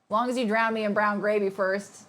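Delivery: usual speaking voice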